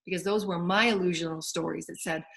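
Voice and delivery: normal talking voice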